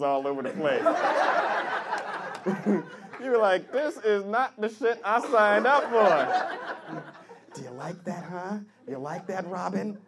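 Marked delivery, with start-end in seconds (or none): 3.7-7.0 slow, drawn-out mock dismay; laboured, higher-pitched complaint; protesting victim. Acts tied-up man protesting. 7.6-10.0 creepy seduction. Acted. Acts seductive captor.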